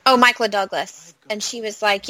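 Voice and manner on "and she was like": ordinary delivery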